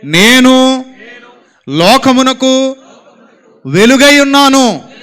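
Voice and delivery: loud, slow self-declaration; said loudly. Shown as a demonstration. Preacher leads a loud, slow personal declaration. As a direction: declare it slowly and loudly about yourself. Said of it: "గట్టిగా చెప్పాలి".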